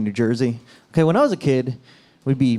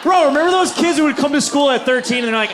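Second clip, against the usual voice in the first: ordinary delivery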